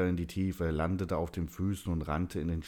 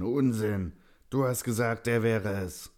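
loud, raised voice of an enraged Santa; angry protest; 6 semitones higher, 5 dB louder than usual. A narrator's reading. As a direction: hurl it back at him, higher and louder. Enraged Santa argues back.

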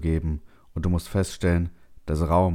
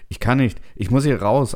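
higher, louder, urgent plea; panicked protest; 6 semitones higher, 6 dB louder than usual. A narrator's reading. Trapped settler protests to the calming computer, panicked. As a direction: burst out, desperate to escape.

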